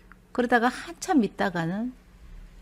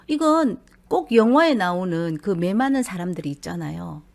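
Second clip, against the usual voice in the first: ordinary talk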